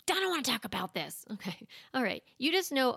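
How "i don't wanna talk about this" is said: put-on refusing child.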